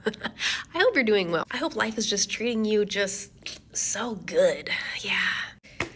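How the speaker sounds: slow, breathy mock-sweet whisper; syrupy sarcastic whisper to an old rival; fake sweet. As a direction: wish her well with poison underneath. Sarcastic well-wishes to old professor.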